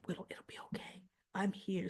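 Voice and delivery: imitating Drew's creepy soothing whisper; mock soothing whisper. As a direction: lull her with a hush.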